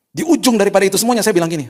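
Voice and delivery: loud, high, impassioned preaching; full-force preaching. Acted. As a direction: drive it home to the congregation. Preaching build.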